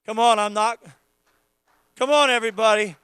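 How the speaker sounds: loud, high, impassioned preaching cry; preaching at full force. Acted. Pastor urging the congregation to worship, shouting fervently. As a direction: rouse the whole church to worship.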